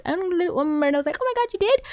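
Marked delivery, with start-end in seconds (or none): 1.2-1.8 high-pitched mock astonishment; quick squeak of imitated surprise; surprised mimicry. Acting Marinette's surprised reaction.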